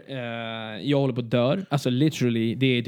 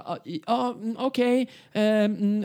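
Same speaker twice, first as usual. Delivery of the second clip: shaky goodbye; 7 semitones higher, slower than usual. Retold or imitated. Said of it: "skakandes så här".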